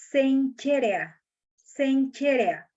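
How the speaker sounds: "Sencherea" said slowly as sen-che-re-a, with ch; careful pronunciation. Shown as a demonstration. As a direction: spell out "Sencherea" syllable by syllable with ch. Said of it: "así se pronuncia". ("Sencherea" pronunciado despacio, sen-che-re-a)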